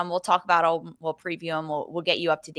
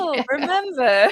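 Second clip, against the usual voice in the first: put-on nostalgic old person; 8 semitones higher, 6 dB louder than usual. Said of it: "we'll be like".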